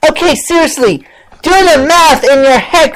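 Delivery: ordinary talk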